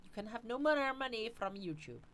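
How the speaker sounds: high-pitched put-on accent, trying for menace; badly faked villain voice with a muddled accent; mock menacing. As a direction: attempt a menacing foreign-sounding voice and miss. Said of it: "I was trying to sound a bit more menacing".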